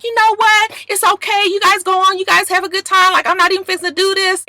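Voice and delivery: high, sugary fake calm hiding anger. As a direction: sweetly say you're fine while seething. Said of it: "how calm she was".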